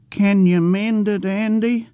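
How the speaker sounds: put-on high, pleading old man's voice, childlike; childish old man pleading. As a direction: ask like a worried simple old man.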